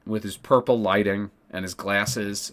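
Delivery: normal talking voice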